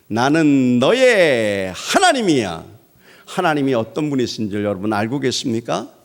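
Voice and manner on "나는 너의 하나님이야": 1 slow solemn God voice. Acted.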